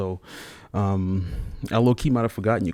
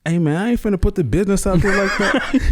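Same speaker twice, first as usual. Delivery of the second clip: high, laughing refusal; laughing dodge; 12 semitones higher, 5 dB louder than usual. Real, not acted. Guest laughing off a question about his kids' names, laughing.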